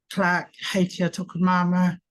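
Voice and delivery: ordinary talk, nothing marked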